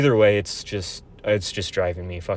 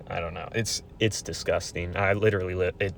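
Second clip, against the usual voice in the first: normal talking voice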